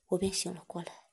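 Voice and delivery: ordinary delivery, nothing marked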